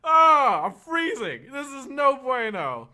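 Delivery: high, loud laughing whine; hammed-up freezing complaint; mock whining. Retold complaint about freezing.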